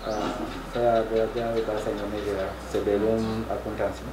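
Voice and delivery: Indonesian with a Ugandan accent; careful learner's Indonesian, Ugandan accent; foreigner speaking indonesian. Real, not acted.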